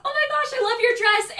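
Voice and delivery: high, loud imitation of gushing mom; squealed retold compliment. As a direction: gush like a mom admiring someone.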